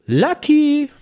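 sehr hoher, lauter, gezogener Namensruf; aufgeregter Schrei. Retold or imitated. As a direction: den Namen aufgeregt in die Höhe schreien. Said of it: "sprach er ganz aufgeregt". (aufgeregtes, schrilles Rufen)

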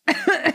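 short dry cough. Real, not acted. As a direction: cough from a swallowed drink. Alkohol in falsche Röhre. (Husten nach dem Verschlucken)

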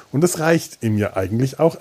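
normal talking voice

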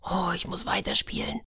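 hushed, startled whisper to himself; frightened whisper. Acted. The boy thinks in fear, whispering. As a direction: breathe it out in a sudden fright. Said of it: "dachte der Junge erschrocken".

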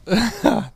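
quick burst of laughter; short high laugh. Real, not acted. Laughing at retold mishap.

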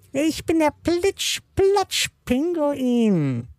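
high, slow cartoon penguin voice; squawky bouncy penguin. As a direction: play a cheerful little penguin, very high. Penguin character speaks.

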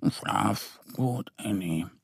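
sleepy, soft little bear mumble; murmuring. A narrator's reading. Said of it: "und murmelt". (schläfrig murmelnd)

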